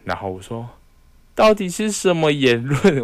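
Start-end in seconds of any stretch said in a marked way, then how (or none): 1.4-3.0 high, slow disbelieving "what kind of claim is that"; drawn-out mock disbelief at a silly idea; retold exasperated question; incredulous retort. Mocking mom's superstition, retold.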